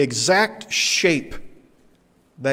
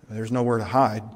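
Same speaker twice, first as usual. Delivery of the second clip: normal talking voice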